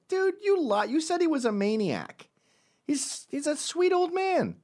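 very high-pitched mock disbelief; incredulous protest. Friend who just met the dad accusing the son, incredulous. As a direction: object in a squeaky, exaggerated protest.

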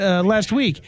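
usual speaking voice

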